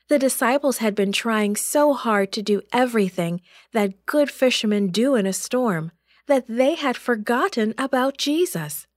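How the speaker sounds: ordinary delivery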